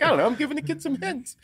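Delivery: high-pitched excuse through laughter; laughing, squeaky self-defence; laughing protest. Retold or imitated. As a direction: plead innocence while cracking up. Defending himself, laughing while telling it.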